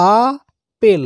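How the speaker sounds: "আ ... পেল" in two separate pushes; one breath for "আ", another for "পেল"; split into syllables. Shown as a demonstration. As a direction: break it into "আ", pause, "পেল". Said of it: "দুটো নিঃশ্বাস লাগছে, মানে দুই ধাক্কাতে".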